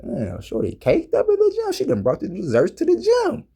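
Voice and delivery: high excited mock whistle voice; a sudden jump up in pitch, impressed; impressed gym ogling. Telling the fellas about staring at a gym girl, high mock amazement.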